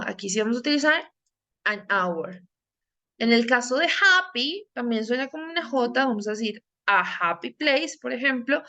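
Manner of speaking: usual speaking voice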